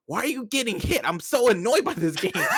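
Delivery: high-pitched mock exasperation; annoyed mom. Mom reacting to son losing his match, annoyed. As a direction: imitate an upset, scolding mother. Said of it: "and she got mad".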